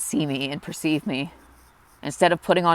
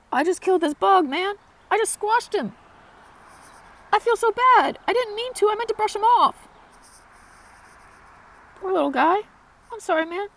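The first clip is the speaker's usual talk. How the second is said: much higher, tender voice, sorry and sweet; apologizing to a bug. Real, not acted. Vlogger apologizing to the bug she squashed, high and tender. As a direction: coo an apology to the tiny creature you just squashed.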